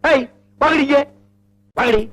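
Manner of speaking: high-pitched irate call; scolding father's sharp summons; angry summons. Acted. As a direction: order the girls over, angrily. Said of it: "இது நிஜமான கோபமா".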